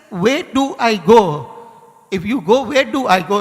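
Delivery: loud, high-pitched cry for direction; retold desperate appeal, very loud; anguished plea.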